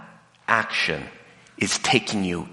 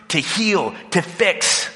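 ordinary talk, nothing marked

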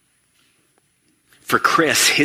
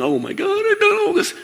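very high, alarmed self-reproach; mock dismay. Parent recognizing their own mistakes, in a high mock panic. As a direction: gasp it out in sudden horror.

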